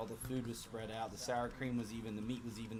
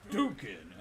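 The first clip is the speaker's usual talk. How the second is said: loud, punchy mock battle shout; video-game attack cry; 8 dB louder than usual. Worker attacking the wrapped burrito, shouted mock attack cry. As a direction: shout it like a special move. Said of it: "And I was like".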